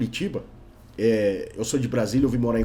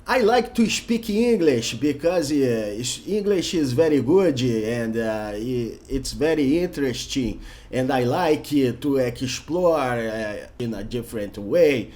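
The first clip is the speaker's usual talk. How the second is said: slow, heavy Brazilian-Portuguese rhythm in English; Brazilian accent English; 3 semitones lower, much slower than usual. Shown as a demonstration. Teacher demonstrating a Brazilian speaking English with Portuguese rhythm. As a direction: drag each English word with a samba-like Portuguese swing, hesitating. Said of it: "um brasileiro falando inglês usando o ritmo do português".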